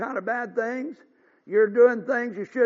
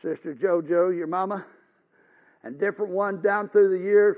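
normal talking voice

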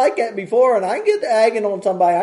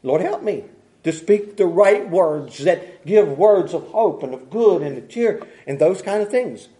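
usual speaking voice

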